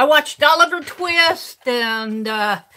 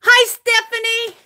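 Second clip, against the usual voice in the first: a bright, much higher hello, a little louder; light up to greet a friend on stream; excited greeting; 9 semitones higher, 3 dB louder than usual. Real, not acted. Greeting a viewer on live stream.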